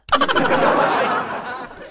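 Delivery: high airy laugh. Real, not acted. Host cracking up at the mother's reply, high laugh. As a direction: burst out laughing.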